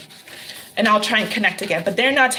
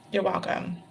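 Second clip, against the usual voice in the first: much softer echo of the thanks; a quiet afterthought you're welcome; softer repeat; 3 semitones lower, 9 dB quieter than usual. Real, not acted. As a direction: drop it almost to a murmur. Thanking viewer, said twice.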